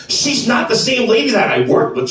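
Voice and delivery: ordinary talk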